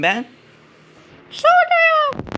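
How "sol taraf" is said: very high, louder cartoon doctor voice, stretched; loud squeaky doctor. Doctor invites the sick patient in, squeaky and loud. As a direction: belt it out as an eager toon doctor.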